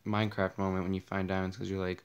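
usual speaking voice

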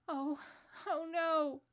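high, loud cry of dismay; anguished girlish wail. A narrator's reading. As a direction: cry out at a terrible discovery. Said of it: "cried the two girls".

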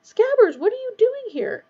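high, loud bewildered question; blank surprise. A narrator's reading. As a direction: exclaim to a lost pet in amazement. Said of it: "said Ron blankly".

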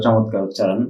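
ordinary talk

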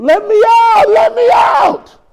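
high, loud desperate scream, drawn out. Retold or imitated. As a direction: imitate a trapped soul screaming. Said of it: "that one who was screaming".

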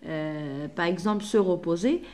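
usual speaking voice

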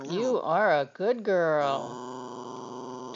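slow, low, drawn-out doggy praise. Real, not acted. Owner praising her dog Ivory, slow and low. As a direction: stretch each word out fondly.

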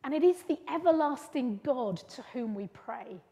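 usual speaking voice

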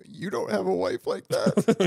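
slow, high, laughing through the words; laughing tease. Real, not acted. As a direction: tease him while cracking up. Teasing friend while laughing.

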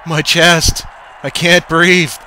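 high, loud desperate cries; panicked pain. A narrator's reading. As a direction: gasp out in fear. Coach collapses in pain.

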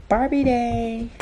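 ordinary talk, nothing marked

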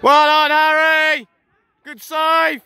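slow drawn-out cheer; loud praise. Real, not acted. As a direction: shout it across the pitch. Praising keeper loudly.

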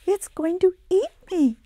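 put-on high, loud scared cry of a little boy; frightened cry. Little boy crying out in fear of the monster. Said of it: "cried Atom".

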